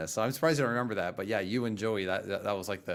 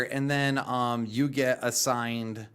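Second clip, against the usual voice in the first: normal talking voice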